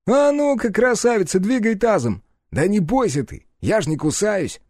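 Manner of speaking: high, loud, brash chivvying; jaunty teasing. Acted. Partner hurries the girl into the car, teasing. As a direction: call it out high, then ease down.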